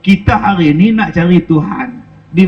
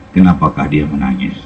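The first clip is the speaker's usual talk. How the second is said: blind Kasim's voice. Retold or imitated. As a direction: speak low and gentle, asking who weeps. Blind Kasim asks about crying.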